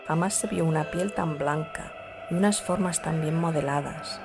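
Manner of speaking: usual speaking voice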